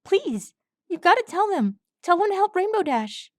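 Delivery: high, cracking plea. A narrator's reading. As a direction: let the voice break as you beg. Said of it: "Swab's voice cracked".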